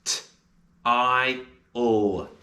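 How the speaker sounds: t, I, l said one at a time; sounding out style. Shown as a demonstration. Teacher sounding out style. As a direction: segment "style" slowly. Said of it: "And the sounds of style are s".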